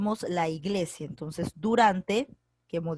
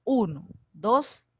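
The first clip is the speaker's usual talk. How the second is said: slow countdown; much slower than usual. Shown as a demonstration. Teacher counting slowly to students before hands go up. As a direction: draw out each number slowly. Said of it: "a la cuenta de tres pueden levantar la mano".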